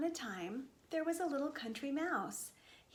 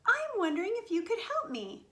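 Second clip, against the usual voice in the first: high, louder mouse voice; hopeful little mouse; 8 semitones higher, 6 dB louder than usual. Acted. Country mouse asks cows for help, high hopeful request. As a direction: ask eagerly in a small high voice.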